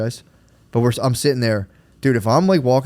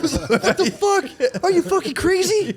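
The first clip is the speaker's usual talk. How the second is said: mock outrage. Mock outrage at slammed door.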